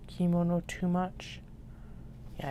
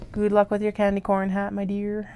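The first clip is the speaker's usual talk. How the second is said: a sudden Southern twang, higher and louder; country twang; 4 semitones higher, 6 dB louder than usual. Pretend. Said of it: "I put a twang on it like that".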